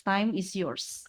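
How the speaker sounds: ordinary talk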